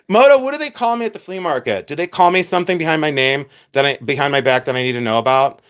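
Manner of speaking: ordinary talk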